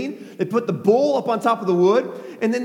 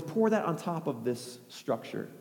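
soft, lower-pitched order; understated, hushed command; quiet instruction; 4 semitones lower, 10 dB quieter than usual. Acted. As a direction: calmly direct helpers, unhurried. Elijah calmly giving orders.

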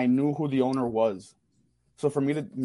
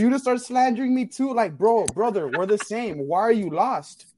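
indignant protest; 6 semitones higher than usual. Real, not acted. Defends himself against teasing.

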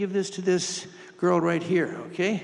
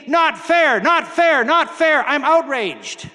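loud, high indignant protest; outraged worker; 6 semitones higher, 7 dB louder, slower than usual. Acted. Worker who worked all day protests equal pay, loud outrage. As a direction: shout it like a cheated worker who feels robbed. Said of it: "And he's thinking".

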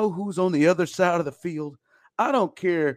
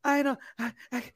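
high whimpering fake fear; mock scared voice; 9 semitones higher than usual. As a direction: whine like a scared fan. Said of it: "And here he comes".

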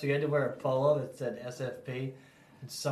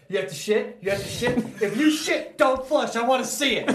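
much higher, louder teasing; hammed-up gleeful shout, higher and louder; mock teasing yell; 10 semitones higher, 8 dB louder than usual. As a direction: imitate Joey hollering after her on the stairs. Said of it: "Joey's like".

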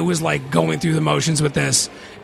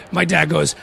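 ordinary delivery

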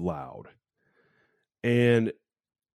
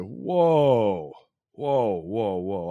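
shocked whoa; 2 semitones higher, 5 dB louder, much slower than usual. Retold or imitated. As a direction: react like you just shocked yourself. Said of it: "I couldn't believe it".

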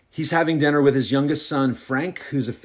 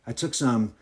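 ordinary delivery, nothing marked

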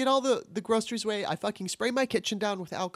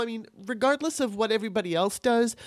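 ordinary talk

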